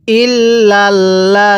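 ইল্লাল্লাযীনা intoned very slowly with held double l; teacher modelling ইল্লাল্লাযীনা in tajweed; slow Quranic recitation. Shown as a demonstration. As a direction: chant ইল্লাল্লাযীনা slowly, stretching the vowels. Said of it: "পড়ুন আমার সাথে".

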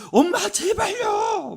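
very high, drawn-out mock cry; son's exasperated plea. Mother imitates her nagged son begging her to stop, exasperated plea. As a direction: stretch out an imitated pleading wail. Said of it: "어느 날은 그런 거예요".

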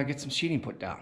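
ordinary delivery

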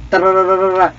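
high rolled rat-a-tat; mock gunfire noise. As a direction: trill out a pretend burst of fire. Imitates gunfire while playing.

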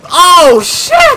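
sudden high panicked yelp; startled yelp. Real, not acted. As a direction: yelp it out in shock. Panicked outburst in firefight.

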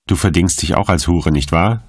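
ordinary delivery, nothing marked

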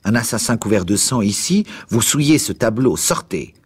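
high-pitched outraged command; furious. A narrator's reading. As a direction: order them out in outrage. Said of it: "ajouta-t-il avec un accent de fureur".

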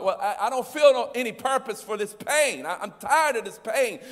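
loud, high, exasperated outburst; fed-up complaint. Retold or imitated. Hurting listener answering the preacher cliche, loud exasperated. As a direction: vent like someone sick of hurting. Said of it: "Because you're like".

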